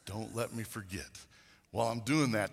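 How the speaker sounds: ordinary delivery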